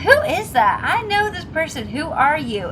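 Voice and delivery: high, insistent mock questioning; acted puzzlement. Viewer puzzles over a familiar face, exaggerated puzzlement. Acting out puzzlement at unknown actor.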